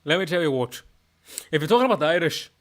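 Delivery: put-on chatty Scottish accent; conversational Scots lilt. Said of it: "my Scottish accent is".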